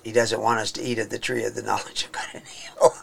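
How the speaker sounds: fast, unvoiced mocking whisper. Mocking the people who call God's rule stupid, fast whisper. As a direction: hiss it out quickly like a sneering aside.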